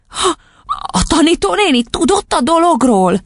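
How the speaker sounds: put-on high little-girl gasp and question; squeaky astonishment; shocked child. A narrator's reading. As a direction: ask in stunned disbelief. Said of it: "döbbent meg Matilda".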